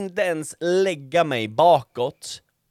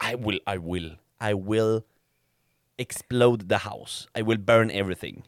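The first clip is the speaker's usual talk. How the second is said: deadpan threat; 7 semitones lower, 4 dB quieter than usual. Retold or imitated. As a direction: mutter it low, pushed past your limit. Retold threat back at flies. (lågt, dämpat hot)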